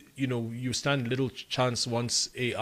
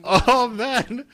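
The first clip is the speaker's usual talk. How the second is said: high, loud laughing protest; laughing dismay; 14 semitones higher, 10 dB louder than usual. Real, not acted. Guest asked by host for one more take, laughing dismay. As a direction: cry out through a laugh, much higher and louder. Playful dismay at a requested retake.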